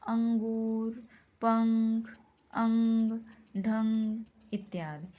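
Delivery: अंगूर, पंख, अंग, ढंग with the nasal sound pushed out loud through the nose; nasal demo words. Shown as a demonstration. Teacher demonstrating nasal अनुस्वार words to the class, loud and humming. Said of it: "जैसे देखिए".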